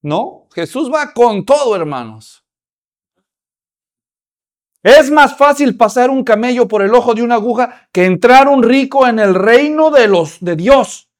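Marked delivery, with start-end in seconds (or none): 4.8-11.1 fiery preaching. Acted. Preaching at full force.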